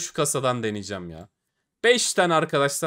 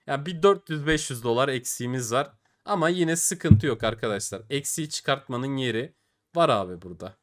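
ordinary delivery

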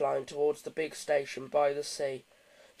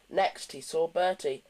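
ordinary delivery

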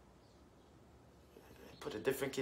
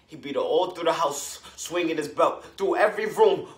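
building louder and higher; agitated retelling; 5 semitones higher, 8 dB louder than usual. A narrator's reading. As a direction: let the horror build blow by blow. Recounting father beating mother.

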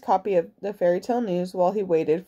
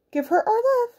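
high, sweet mother bear voice; high Missus Bear voice; 13 semitones higher than usual. Acted. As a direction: lift it high like a kindly bear.

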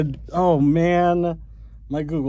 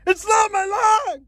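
high shout. Real, not acted. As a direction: shout it in mock outrage. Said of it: "Oh, that's not my line".

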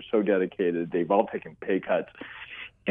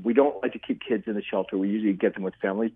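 normal talking voice

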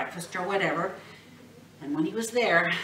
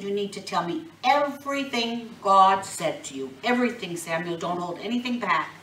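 stern demand; 8 dB louder than usual. Acted. Eli insisting firmly.

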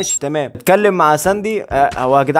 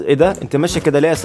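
usual speaking voice

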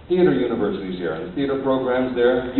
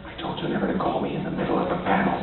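quick, urgent whisper; hushed rebuke; 7 dB quieter, faster than usual. Acted. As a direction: whisper a sharp reproach under your breath. Fake phone call in a scene.

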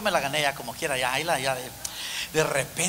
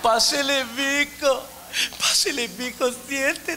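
put-on high, syrupy old lady voice drawn out slow; syrupy granny; 8 semitones higher, slower than usual. Future grandmother-in-law sweetly welcoming him, syrupy. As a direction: ooze sweetness like a doting grandma. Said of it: "puro piloncillo".

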